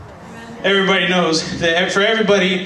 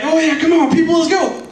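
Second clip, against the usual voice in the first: hyped gospel singer; 10 semitones higher than usual. Retold or imitated. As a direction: whip the crowd up, pitched high. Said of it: "He's like".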